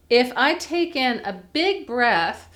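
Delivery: normal talking voice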